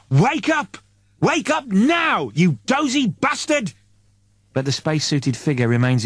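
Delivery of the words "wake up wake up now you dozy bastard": high, slow shout to wake him; calling out loudly. Acted. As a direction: yell it up to the unconscious man, stretching each word. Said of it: "Eddie calls again louder".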